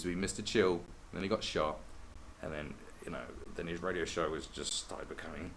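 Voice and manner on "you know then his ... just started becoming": normal talking voice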